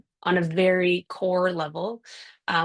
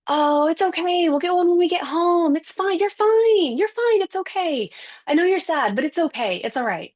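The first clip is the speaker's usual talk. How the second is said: put-on much higher, louder sing-song soothing of a crying child; soothing active listening; 11 semitones higher, 4 dB louder than usual. Shown as a demonstration. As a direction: overdo the reassuring parent, pitched way up. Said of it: "it would have gone something like this".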